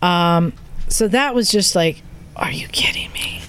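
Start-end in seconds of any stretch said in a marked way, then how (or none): 2.4-3.4 breathless whispered disbelief; awed whisper. Retold or imitated. Telling friends she rode new bikes, whispered amazement. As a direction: whisper it like you can't believe your luck.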